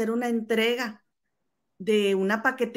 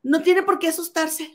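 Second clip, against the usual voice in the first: mock angry lady; 7 semitones higher, 3 dB louder than usual. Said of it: "La señora bien enojada".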